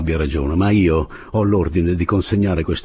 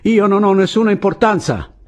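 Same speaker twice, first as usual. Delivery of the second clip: high, loud pleading; raised high voice, insisting; shouted appeal; 12 semitones higher, 5 dB louder than usual. A narrator's reading. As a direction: call out to men holding a bomb. Shouted plea to armed soldiers.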